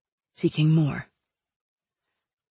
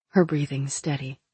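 usual speaking voice

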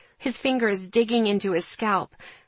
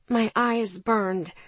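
ordinary delivery, nothing marked

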